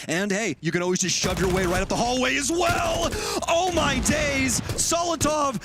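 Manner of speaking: rising hype, shouting the call; excited play-by-play. Real, not acted. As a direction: build up and shout the big moment.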